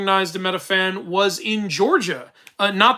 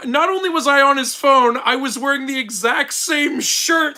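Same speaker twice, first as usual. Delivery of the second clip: excited; 6 semitones higher than usual. Real, not acted. Excited retelling of being recognized.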